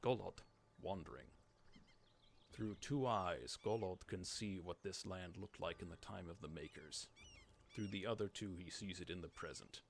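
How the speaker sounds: low, hushed storyteller read of game lore; solemn narrator voice for ancient lore; lore narration. A narrator's reading. Reads in-game lore text aloud.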